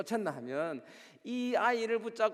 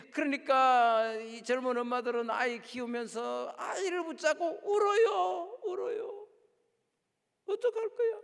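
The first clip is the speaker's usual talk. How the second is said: much higher and louder, voice breaking into a cry; impassioned crying out; 6 semitones higher, 6 dB louder than usual. Acted. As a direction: cry out for the weeping mothers at full force. Preaching about crying young mothers. (울먹이며 외치는 설교)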